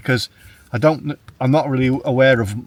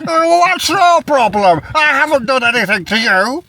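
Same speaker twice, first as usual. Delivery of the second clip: Zippy from Rainbow; 13 semitones higher, 5 dB louder than usual. Pretend. Zippy snapping back at George, very high puppet voice. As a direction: whine back like an indignant puppet. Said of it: "impression of Zippy from Rainbow".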